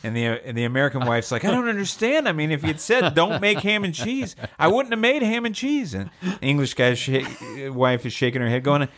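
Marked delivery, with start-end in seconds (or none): 1.5-5.9 high, wailing widow voice; mock crying. American widow at the funeral, mock-tearful. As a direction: play a hammed-up tearful protest, pitched high. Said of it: "and they're crying".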